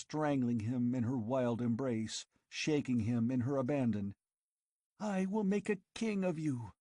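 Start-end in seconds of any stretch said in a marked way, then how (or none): 5.0-6.7 higher, feverish visions of riches; girl tempting her lover with an empire; passionate scheming girl.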